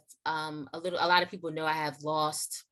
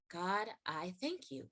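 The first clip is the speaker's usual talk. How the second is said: slow, softer, sincere prayer of thanks; quiet thanks; 8 dB quieter, slower than usual. Real, not acted. Leaving the room after the offer, a slow, soft thank-you to God. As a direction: breathe out gratitude to God.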